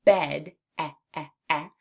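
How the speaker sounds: "bed" then short "eh" sounds; isolating the vowel. Shown as a demonstration. Teacher isolates the vowel in "bed". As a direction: pull out the "eh" from "bed". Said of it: "in the word bed".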